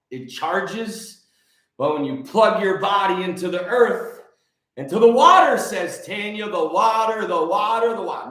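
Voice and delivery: ordinary delivery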